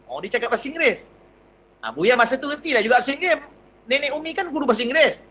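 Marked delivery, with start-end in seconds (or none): none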